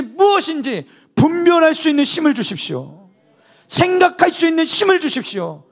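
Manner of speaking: loud, high, impassioned sermon delivery; fervent preaching. Acted. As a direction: preach it with rising fervor. Preaching at full force. (힘 있게 외치는 설교)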